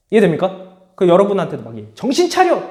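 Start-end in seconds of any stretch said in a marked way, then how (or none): 1.9-2.6 high, sharp wake-up call; an agitator rallying the class; rousing call. Acted. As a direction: shake them awake. Conflict theorist rousing students.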